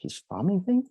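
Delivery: farming thing? rising sharply high in disbelief; incredulous echo. Retold or imitated. Echoing his buddy's pitch with a high, baffled question. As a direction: throw the words back as a baffled question.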